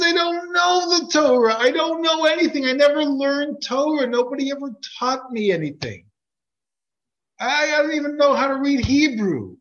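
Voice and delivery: high, loud, anguished protest; desperate shout. Retold or imitated. Innkeeper protesting to the rabbi in a high desperate shout. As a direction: cry out that you know nothing. Innkeeper panics about learning Torah.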